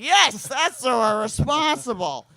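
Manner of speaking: put-on high, loud, drawn-out drunken protest; hammed-up drunken self-defense; indignant insistence. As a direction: insist at full volume, dragging the words out.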